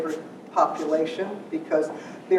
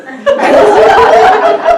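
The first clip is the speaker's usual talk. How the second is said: loud burst of laughter; 20 dB louder than usual. Real, not acted. Bursts out at kid's reply.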